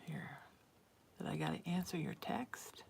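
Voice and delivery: soft unvoiced whisper. Real, not acted. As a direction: whisper it under your breath. Aside while answering texts.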